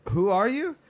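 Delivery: high, loud startled question; bewildered at a stranger at the door; confused. Retold or imitated. Her own confused reply.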